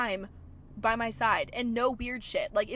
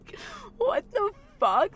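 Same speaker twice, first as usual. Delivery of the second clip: much higher, louder delighted squeal; excited squeal; 12 semitones higher, 5 dB louder than usual. Real, not acted. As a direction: squeal it in disbelief. Swooning over door gesture.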